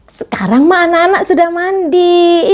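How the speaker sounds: ordinary talk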